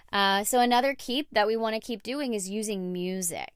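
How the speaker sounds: usual speaking voice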